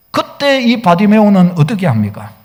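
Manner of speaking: usual speaking voice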